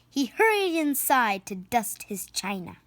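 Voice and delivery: usual speaking voice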